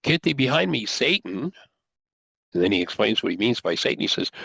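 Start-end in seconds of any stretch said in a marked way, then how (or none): 0.0-1.5 stern rebuke. Retold or imitated. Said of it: "He turns to Peter, he says".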